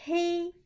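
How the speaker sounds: Hei held high and flat; first tone hei. Shown as a demonstration. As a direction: Hei on one steady high pitch. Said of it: "comment dire, euh, la couleur noire".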